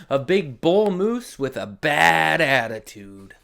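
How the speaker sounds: lively, sing-song read-aloud; animated storybook voice with dramatic pauses; reading to children. Acted. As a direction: read it big for the little ones. Reads picture book to children.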